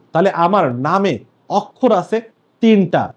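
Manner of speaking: ordinary talk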